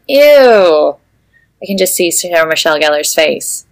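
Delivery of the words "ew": a short, loud "Ew"; disgusted ew. Acted. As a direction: recoil at the soup. Buffy reacts in disgust.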